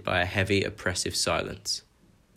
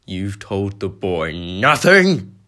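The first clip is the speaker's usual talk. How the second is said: very slow, building to a loud bellow on the last word; a giant bellowing at the uncle who lied; contained fury building to a bellow; 8 dB louder, slower than usual. Acted. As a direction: hold it in, then let it explode. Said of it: "his voice shaking with barely contained fury".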